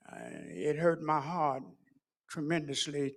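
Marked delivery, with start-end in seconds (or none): none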